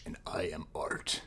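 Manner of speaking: a soft, low whisper on the last words; whispering the final boast. Pretend. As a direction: breathe it out like a secret. Whispered end of persona quote.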